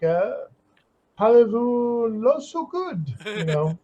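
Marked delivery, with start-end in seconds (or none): 1.2-3.1 slow, higher mock French accent. Joking about bad French, in a slow mock French accent. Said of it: "Your French would become like".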